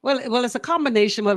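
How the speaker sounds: usual speaking voice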